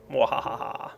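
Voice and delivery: theatrical villain cackle; mad scientist laugh. Acted. Gloating over his defensive plan.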